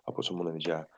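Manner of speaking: a low murmured promise to the swear jar; quiet aside. Real, not acted. Aside to stream about swearing.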